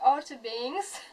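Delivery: "Outer Banks" said slowly in an English accent. Shown as a demonstration. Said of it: "je vais prendre un, un accent anglais".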